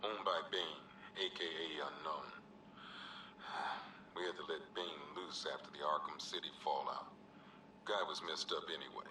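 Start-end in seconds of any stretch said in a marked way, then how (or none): 2.3-4.1 weary sigh. Acted. Cop sighs over Bane's release.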